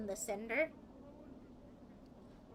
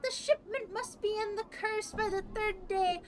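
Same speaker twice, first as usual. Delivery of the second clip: high, louder chanted recitation; recited mantra; 10 semitones higher, 5 dB louder than usual. Acted. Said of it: "recites the message in an almost like man-, a mantra".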